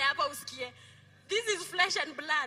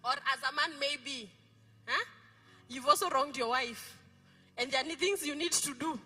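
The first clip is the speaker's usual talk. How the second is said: ordinary talk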